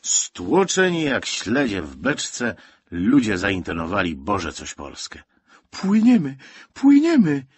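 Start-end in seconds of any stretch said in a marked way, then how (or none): 5.7-7.4 very high, loud cry of joy; joyful shout. A narrator's reading. Boy announces the ship is sailing, joyful shouting. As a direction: whoop it out excitedly. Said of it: "wykrzyknął radośnie Józek".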